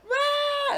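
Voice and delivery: very high, loud battle shriek; one piercing charge scream, played for laughs; mock war scream. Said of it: "I'll be just scream".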